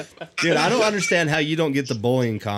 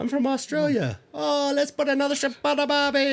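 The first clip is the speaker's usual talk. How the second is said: high-pitched mock Australian accent; 13 semitones higher than usual. Retelling a movie line, high mock Australian accent. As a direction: imitate her Aussie line, way up high. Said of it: "and she's like".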